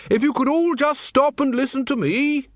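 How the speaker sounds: high, carrying bellow to the crowd. A narrator's reading. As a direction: call out over the marchers. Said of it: "he bellowed".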